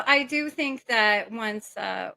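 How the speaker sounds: ordinary talk, nothing marked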